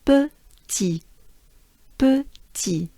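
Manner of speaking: petit split into peu and ti, high then falling; careful French petit, silent final t; syllable by syllable. Shown as a demonstration. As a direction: sound out petit in two separate French syllables. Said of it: "together they form".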